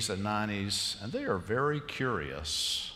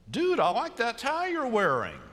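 a light mock of the teller's higher, louder compliment; imitating the teller; 10 semitones higher, 4 dB louder than usual. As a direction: toss off the casual compliment, a touch higher. Teller quoted in story.

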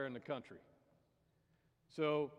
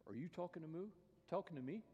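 hesitant questioning; 3 semitones higher, 7 dB quieter, faster than usual. Acted. Baffled man questions the frog.

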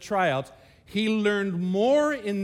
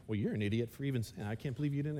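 muttered insult; 7 semitones lower, 9 dB quieter, much faster than usual. Retold or imitated. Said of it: "I'm like".

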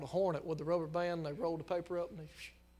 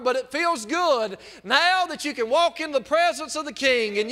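much higher and louder chanted preacher's whoop; full-force preaching; 6 semitones higher, 11 dB louder than usual. Real, not acted. Preacher retelling words to the saved girl, whooping loud and high. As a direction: soar into the preacher's tuneful climax.

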